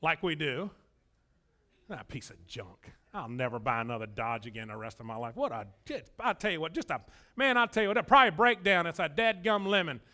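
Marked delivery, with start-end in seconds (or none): 1.9-5.3 cursing the car. Retold or imitated. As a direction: mutter curses at the car, disgusted. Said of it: "and you curse it like we do".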